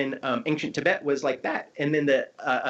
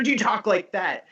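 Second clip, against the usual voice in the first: higher, slightly louder questioning voice; raised inflection demo; 5 semitones higher, 3 dB louder than usual. Shown as a demonstration. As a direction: lift the pitch to show a contrasting tone. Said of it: "shape it with inflection".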